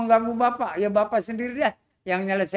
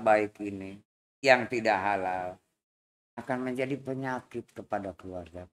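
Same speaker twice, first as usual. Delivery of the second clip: preaching elder; 10 semitones lower than usual. Acted. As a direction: preach gently to the robbers. Old man preaches advice. (nasihat kakek seperti ceramah, rendah dan pelan)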